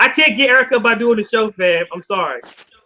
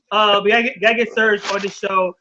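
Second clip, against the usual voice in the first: ordinary delivery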